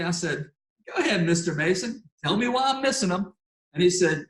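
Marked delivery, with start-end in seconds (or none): 0.9-3.3 sarcastic. Retold or imitated. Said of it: "So sarcastically".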